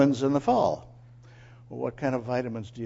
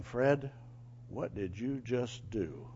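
slow, low, soft reproof; a quiet, grave question; Jesus; 3 semitones lower, 10 dB quieter, slower than usual. Retold or imitated. Jesus questions man who hit his dog, quietly reproving. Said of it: "Jesus was saying something to me".

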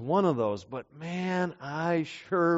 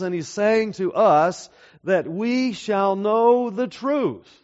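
louder, pressing each phrase home like a preacher; preaching at full force; 4 semitones higher, 5 dB louder than usual. Acted. Preacher rejecting relativism to the congregation, in a loud forceful preaching voice. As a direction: build the sermon to a firm, loud conviction.

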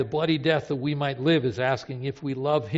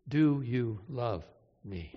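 slow and low, each word weighed; a slow, aching repeat of the question; slow, heavy plea; 5 semitones lower, 3 dB quieter, slower than usual. Retold or imitated. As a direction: let the last ask sink slowly. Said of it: "Jesus asked three times".

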